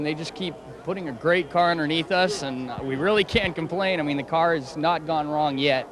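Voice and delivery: ordinary talk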